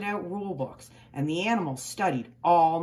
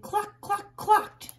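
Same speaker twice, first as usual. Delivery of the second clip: high clucking like a hen; clucking chickens; 13 semitones higher, much slower than usual. A narrator's reading. Narrator voicing chickens clucking with excitement.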